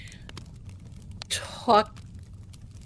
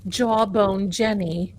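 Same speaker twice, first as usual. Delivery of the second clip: ordinary talk, nothing marked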